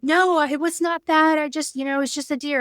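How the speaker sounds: mock defensive husband. Husband defends himself, retold argument.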